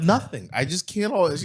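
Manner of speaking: high-pitched defensive huff; girl logic. Retold or imitated. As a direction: imitate her touchy comeback. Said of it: "hit me with that girl logic".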